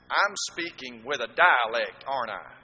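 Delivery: ordinary talk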